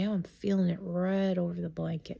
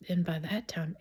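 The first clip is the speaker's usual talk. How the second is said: ordinary talk, nothing marked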